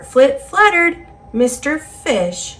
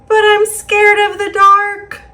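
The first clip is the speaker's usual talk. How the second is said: higher and louder, a frightened cry; scared; 10 semitones higher, 5 dB louder than usual. Acted. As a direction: let the fear burst out on the last line. Fish admits fear, refrain.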